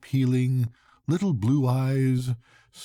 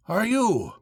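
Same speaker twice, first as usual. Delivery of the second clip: much higher, called out over the noise; shouted question; 10 semitones higher than usual. A narrator's reading. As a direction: shout it from the wheel. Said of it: "I shouted from the wheel".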